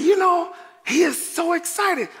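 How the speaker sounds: high, delighted retelling; high-pitched delight; amused remark. Retold or imitated. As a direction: marvel at the baby in a high voice. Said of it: "And I looked, I said".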